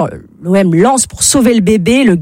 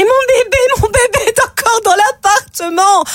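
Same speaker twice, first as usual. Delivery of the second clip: high, shrill-free panicked cry, pitched well up; hammed-up mother crying for her baby; mother screaming for her baby in the fire, mock panic; 13 semitones higher than usual. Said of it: "Une femme commence à hurler".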